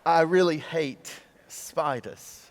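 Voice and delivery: slow, sheepish confession; embarrassed, drawn-out admission. Acted. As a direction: admit it haltingly, with an Aussie touch. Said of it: "The man sheepishly steps back".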